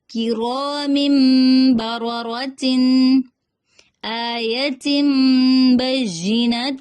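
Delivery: slow drawn recitation of each tanween pair; tanween drill. Shown as a demonstration. Teacher reciting tanween examples for students, slow drill. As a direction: chant each pair out for the class to repeat. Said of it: "میں پڑھاؤں گی".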